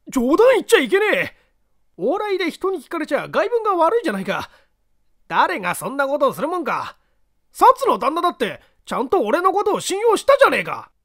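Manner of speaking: loud, high, fast flustered protest; panicked denial. Acted. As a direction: deny it in a panic.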